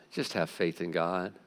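Jesus quietly reassuring his disciples; calm Jesus. Acted. Jesus answering Peter, soft, low and calm. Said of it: "And Jesus answered".

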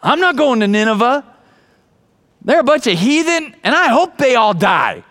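a sulky refusal; stubborn Jonah. Retold or imitated. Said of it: "Jonah's like".

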